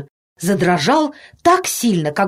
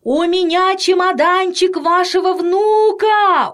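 high, drawn-out cry of desperation; desperate shout; 11 semitones higher, slower than usual. A narrator's reading. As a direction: holler it up as a last resort. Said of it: "в полном отчаянии крикнул детский доктор". (отчаянный протяжный крик)

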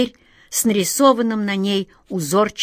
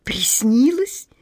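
slow, higher puzzled question; bewildered; 4 semitones higher, slower than usual. A narrator's reading. Girl asking the wizard if she dreamed it, bewildered. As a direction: let the word climb slowly in bewilderment. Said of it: "недоумённо спросила она".

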